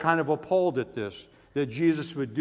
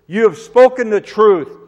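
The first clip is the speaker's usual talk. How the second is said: emphatic affirmation; 7 semitones higher, 12 dB louder than usual. Acted. Voicing the woman's answer.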